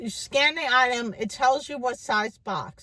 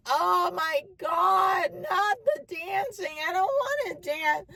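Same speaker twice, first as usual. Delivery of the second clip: higher, pleading refusal; whiny protest; 6 semitones higher than usual. Retold or imitated. Refusing her friends' push to dance, whiny and high. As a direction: beg to get out of dancing. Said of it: "And I was like".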